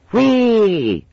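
put-on high loud child's squeal of fun; a kid riding a seesaw; gleeful whee. A narrator's reading. Child on ducking stool.

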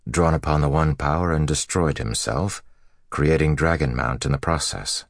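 ordinary delivery

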